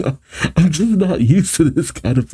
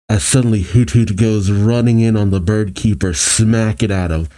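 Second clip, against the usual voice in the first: ordinary delivery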